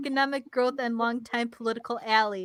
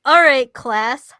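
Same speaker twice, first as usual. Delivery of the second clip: mock teacher voice; 8 dB louder, much slower than usual. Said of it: "mock teacher".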